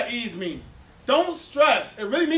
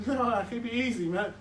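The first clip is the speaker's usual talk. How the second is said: ordinary talk, nothing marked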